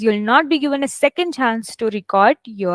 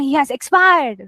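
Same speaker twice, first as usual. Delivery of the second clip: exclaimed as if good news; wrongly upbeat. Shown as a demonstration. Teacher shows how not to read a sad sentence, exclaimed. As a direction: punch out the sad line too brightly. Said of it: "So you're not gonna".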